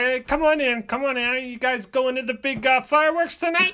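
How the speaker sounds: imitating a friend's dad, high and eager; high-pitched dad welcoming the kids in; high-voiced dad. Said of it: "So he's like".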